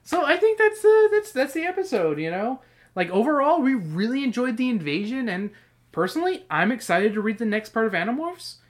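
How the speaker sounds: high, sped-up squeaky voice; sped up like a chipmunk. Acted. I might do that right here.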